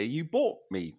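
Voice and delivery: usual speaking voice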